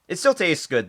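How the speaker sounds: high, loud insistence. Real, not acted. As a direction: blurt it out loudly. Loud take then calm repeat.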